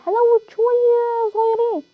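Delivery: high, slow, drawn-out begging whine; whiny pleading. Real, not acted. Gamer begs teammates to let him loot, whining.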